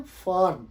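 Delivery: "फॉरेन" as one long syllable, faarn; correct pronunciation. Shown as a demonstration. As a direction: drop the second vowel of फॉरेन. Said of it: "तो इसको पढ़ते हैं".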